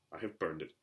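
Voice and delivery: low, soft, short uncle; lightly voiced curt uncle. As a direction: cut him off, low and terse. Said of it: "said Uncle Vernon shortly".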